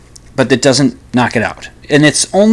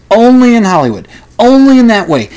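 mocking scorn; 10 semitones higher, 7 dB louder than usual. Real, not acted. Scoffing at Hollywood.

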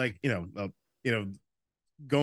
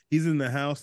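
usual speaking voice

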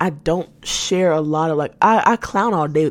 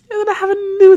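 excited; 10 dB louder than usual. Real, not acted. Excited about new therapist.

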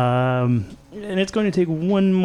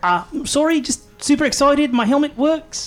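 higher squeaky excited voice; high-pitched voice; 7 semitones higher than usual. Acted. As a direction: talk high like a giddy little guy. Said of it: "your voice got higher".

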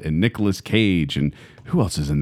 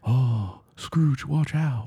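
slow, soft British accent impression; quiet Nicolas Cage British accent; 4 semitones higher, 5 dB quieter, much slower than usual. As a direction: murmur it in a British accent, slowly. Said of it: "quiet Nicolas Cage do a British accent".

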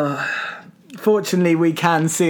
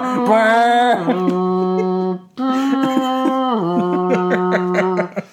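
long, held foghorn blast by mouth; imitated ship horn; 2 semitones higher than usual. Co-host mimics ship leaving harbour, long horn drone. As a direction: drone like a departing ship's horn. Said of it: "That's a ship leaving harbour".

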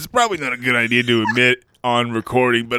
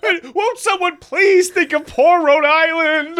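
high, slow hammed-up shriek of concern; mock panicked plea, laughing at the end; hysterical do-gooder; 4 dB louder than usual. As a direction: beg like a frantic church lady. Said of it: "won't somebody please think of the children".

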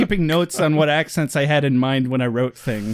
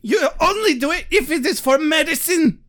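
mock German accent; 12 semitones higher than usual. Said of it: "mock German lines".

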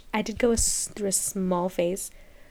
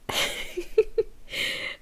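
breathy laugh; 11 semitones higher, 4 dB quieter than usual. Real, not acted. Laughing at own rant.

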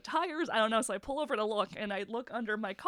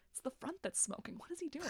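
much softer, puzzled aside; muttered aside; 4 semitones higher, 13 dB quieter than usual. Retold or imitated. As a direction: say it under your breath to the crew. Firefighters muttering among themselves.

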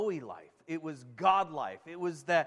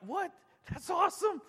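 high-pitched, thrilled disbelief; excited; 12 semitones higher than usual. Real, not acted. Preacher excited to congregation about the fruit tree, amazed. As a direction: let amazement lift the voice high. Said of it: "I'm getting excited about this tree".

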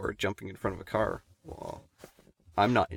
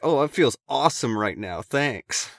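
louder, higher sarcastic retort; 5 semitones higher, 6 dB louder than usual. Retold or imitated. Answering panicking friends while high on shrooms, sarcastic. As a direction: lay on the sarcasm, raised and lively. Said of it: "I'm just being so sarcastic".

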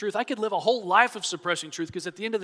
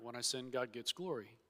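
'glory' dropped much softer, a mock muttered repeat; mocking echo; 4 semitones lower, 14 dB quieter than usual. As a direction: echo the excuse quietly with scorn. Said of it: "everybody could use that argument".

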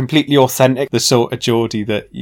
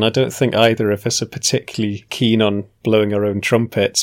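usual speaking voice

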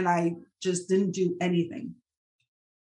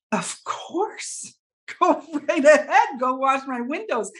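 high, breathy start breaking into a laugh, then louder; relieved laughing yes; 5 semitones higher, 3 dB louder, slower than usual. Retold or imitated. To the neighbor offering to wash her windows, laughing high relief.

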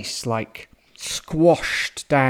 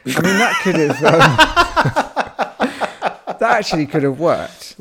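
laughing while talking; words broken by laughter; 6 semitones higher, 6 dB louder than usual. Real, not acted. Laughs through his words.

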